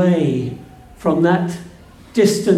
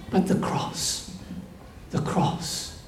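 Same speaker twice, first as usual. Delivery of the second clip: breathy hushed whisper; 13 dB quieter than usual. Acted. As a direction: drop to a reverent whisper. Hushed climax on the cross.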